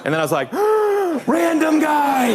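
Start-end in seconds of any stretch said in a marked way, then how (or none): 0.6-1.2 high, sharp gasp of sudden realization; shocked gasp. Acted. Sudden realization of who is calling. 1.3-2.3 high, slow, drawn-out cry of recognition; thrilled realization. Acted. Realizes who is calling, thrilled.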